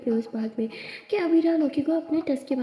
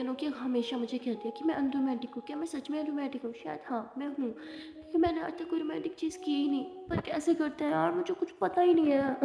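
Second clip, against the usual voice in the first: musing to herself; 2 semitones higher, 8 dB quieter than usual. Acted. Abira thinking to herself.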